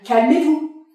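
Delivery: lance un calmez-vous sec et fort; ordre crié. Acted. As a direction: hausse la voix pour le faire taire. Agent orders the man to calm. (calmez-vous crié plus haut et plus fort)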